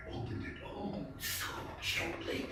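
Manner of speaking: breathless, hushed whisper; stealthy whisper. Acted. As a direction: whisper as if afraid to wake the old man. Creeping into the room.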